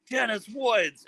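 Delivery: high, delighted call-out; mock a thrilled shout of recognition; excited greeting. Singer spotting a fan at the show, excited high call-out.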